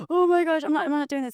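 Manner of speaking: high, loud panicked squeal; high-pitched nervous panic; mock panic. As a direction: act out freaking out before finals. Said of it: "I get very, like".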